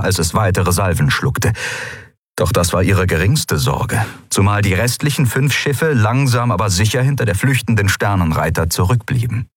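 usual speaking voice

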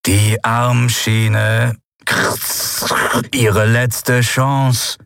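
slow, crackling robot voice through a grille; rasping machine voice. Acted. Dying robot rasping at the crew through its grille. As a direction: grind the words out like a broken machine.

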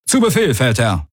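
obedient crew reply. A narrator's reading. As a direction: answer like a soldier on duty.

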